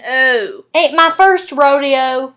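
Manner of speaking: slow Southern drawl with rounded O; Southern accent. Shown as a demonstration. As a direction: drawl it loudly, lips pulled together on the O. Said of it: "The O sound then becomes O".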